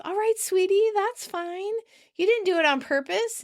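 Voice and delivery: high-pitched cooing reassurance; soothing. Retold or imitated. Monica forgiving a frightened Phoebe, soothing. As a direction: comfort her as if soothing a child.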